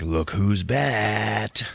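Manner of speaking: long drawn-out pun, proudly announced; triumphant hero entrance. Retold or imitated. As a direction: stretch the last word like a showman.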